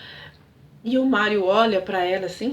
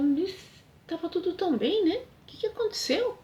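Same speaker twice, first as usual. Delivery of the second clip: much higher, softer bewildered plea; 8 semitones higher, 3 dB quieter than usual. Retold or imitated. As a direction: ask the empty room, hurt and lost. Alice bewildered after he leaves.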